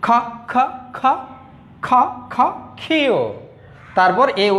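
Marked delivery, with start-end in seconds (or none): 0.1-3.2 short isolated খ beats, then high falling ক্ষীয়; letter sound drill. Shown as a demonstration. Teacher shows how Q is pronounced, sound drill. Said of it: "এটার উচ্চারণটা কিভাবে হবে? এই খ এর মতো".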